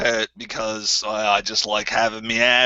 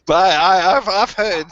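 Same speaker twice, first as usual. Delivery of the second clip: higher, louder put-on accent after a southern slip; overcorrected accent, pitched up high; accent put back on; 6 semitones higher, 4 dB louder than usual. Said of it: "my accent just went southern for a little".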